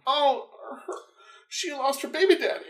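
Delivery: high-pitched pitying coo; high sympathetic gossip voice; mock sympathy. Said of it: "I'm like".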